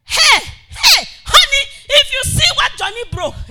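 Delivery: loud, high yelling wife. Retold or imitated. Wife shouting at her husband about the broken thing. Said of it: "your wife gonna say".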